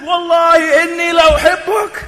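high, loud, impassioned "Wallahi inni la uhibbuk"; full emphasis. Shown as a demonstration. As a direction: drive "la uhibbuk" home at full voice. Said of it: "how many emphasis is there?".

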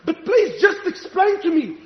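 urgent, exasperated preacher's appeal; impassioned preaching. Real, not acted. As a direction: challenge the congregation with rising passion. Preaches at full force.

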